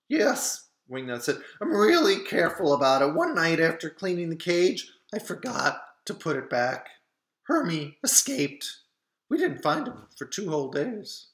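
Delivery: put-on loud, fast eager kid; excited boy explaining. A narrator's reading. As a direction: rush it out, a keen boy. Wingnut answers eagerly.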